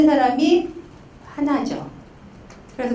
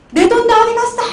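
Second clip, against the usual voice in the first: high, loud mock outburst; acted angry yell; 11 semitones higher, 6 dB louder than usual. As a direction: yell it like an indignant husband. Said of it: "소리 지르는 거죠".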